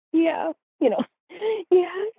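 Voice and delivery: laughing sheepish admission to mom. Retold or imitated. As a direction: giggle it out guiltily. Admitting with a laugh.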